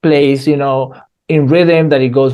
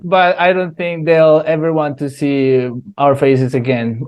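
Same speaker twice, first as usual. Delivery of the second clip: normal talking voice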